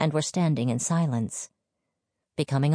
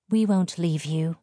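ordinary delivery, nothing marked

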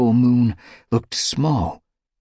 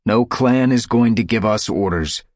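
louder, firm insistence; insisting; 5 semitones higher, 5 dB louder than usual. A narrator's reading. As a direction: plant each word like a leader. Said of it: "Firestar insisted".

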